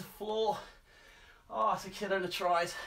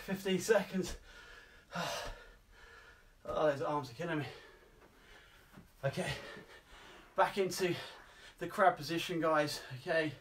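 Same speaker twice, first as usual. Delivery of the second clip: ordinary talk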